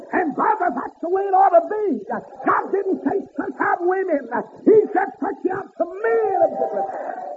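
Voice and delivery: high ringing preacher's cry; preaching at full force. Acted. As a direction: lift it up and ring it out to the congregation.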